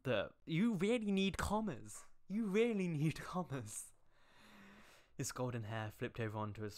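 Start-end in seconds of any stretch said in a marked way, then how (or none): 0.0-2.0 exasperated scolding. Acted. Scolding author about commas. 2.3-3.9 exasperated scolding. Acted. Repeats scolding.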